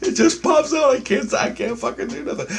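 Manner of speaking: high, helpless laughing protest; talking through laughter. Real, not acted. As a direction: squeeze the words out while cracking up. Still laughing at his own example.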